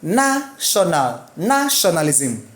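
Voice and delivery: slow "National", "Nationalism" with held NAH; stress on first syllable. Shown as a demonstration. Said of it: "rules for syllabic stress".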